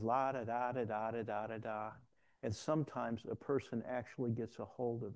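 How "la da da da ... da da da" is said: droning la-da-da singsong; empty syllables running on and tailing off; rote praying. Retold or imitated. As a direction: imitate someone rattling through a rote prayer. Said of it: "sometimes what you're hearing is".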